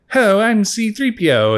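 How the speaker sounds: high, cheery C three P O voice; bright, polite droid introducing itself; chipper robot greeting. Retold or imitated. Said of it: "he's like".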